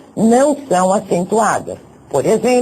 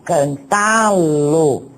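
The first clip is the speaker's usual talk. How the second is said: slow demo word; much slower than usual. Shown as a demonstration. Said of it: "acompanhado por um pronome pessoal".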